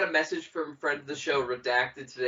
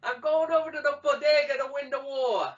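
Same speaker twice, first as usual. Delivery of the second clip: put-on New York accent; 10 semitones higher than usual. Continuing the New York bit.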